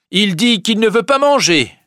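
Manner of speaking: very high, loud servant's voice; servant Nanon. A narrator's reading. Nanon reports that Charles will not eat, high loud exclamation.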